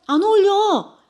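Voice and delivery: loud, high, blunt "안 어울려!"; a thinker bluntly telling a friend the haircut doesn't suit her, in a loud shout. Retold or imitated. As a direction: blurt the verdict right out, no softening. Blunt thinking-type verdict acted out.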